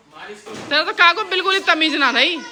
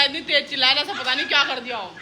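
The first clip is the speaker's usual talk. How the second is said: ordinary talk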